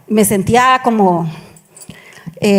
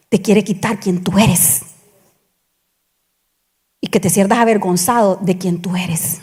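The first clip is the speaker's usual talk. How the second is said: soft breathy whisper for emphasis; 3 semitones lower, 5 dB quieter than usual. Real, not acted. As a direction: drop to a hushed whisper to drive it home. Preacher drops to a whisper.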